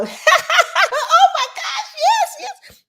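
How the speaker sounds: shrieking with laughter, very high and loud; hammed-up high laughing yell, drawn out slowly; laughing shout. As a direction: mimic a friend cackling on speakerphone. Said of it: "and they y'all".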